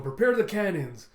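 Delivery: high, loud imitated battle command; shouted order. Beast Pirates readying for battle, imitated shouted order. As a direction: call the order across the deck. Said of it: "you see the Beast Pirates going".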